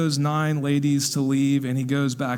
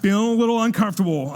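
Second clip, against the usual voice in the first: uncomfortable; 4 semitones higher, faster than usual. Retold or imitated. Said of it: "And Tony's, like".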